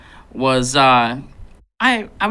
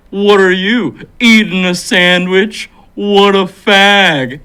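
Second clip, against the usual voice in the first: loud, slow, drawn-out taunt; jeering bully; 4 semitones higher, 8 dB louder, much slower than usual. Retold or imitated. Kid at back of bus to sandwich eater, mocking jeer. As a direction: imitate a kid heckling across the bus.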